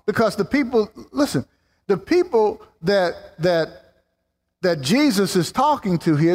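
rising, insistent preacher voice; building sermon delivery; fervent preaching. Acted.

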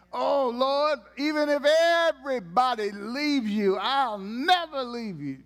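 lightly voiced boastful disciple; bragging Peter. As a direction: play Peter swearing loyalty. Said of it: "Peter was always bragging".

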